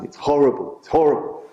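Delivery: half-voiced cry of horror; mild mock shout. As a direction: imitate a shout, held back. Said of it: "just shouting".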